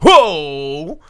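loud, high amazed gasp; surprised whoa. Retold or imitated. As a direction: react to a shocking trailer. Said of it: "and I was like".

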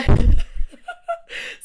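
high, loud airy laugh; breathless squeal laugh. Real, not acted. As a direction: shriek at own cheeky joke. Laughing at own innuendo.

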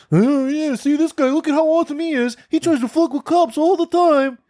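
high-pitched mocking hype voice; sarcastic squeal of fake admiration; mock fanboy. Mocking clout-chaser's fans cheering him.

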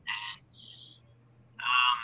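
metallic, hollow audio; like talking through a tin can; tin can sound. Real, not acted. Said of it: "it sounds like you're in a tin can".

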